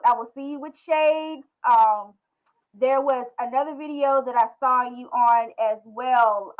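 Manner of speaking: ordinary delivery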